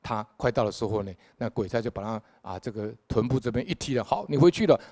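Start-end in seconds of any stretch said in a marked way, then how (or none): 4.0-4.8 brisk command. Retold or imitated. Ghost official kicks him back.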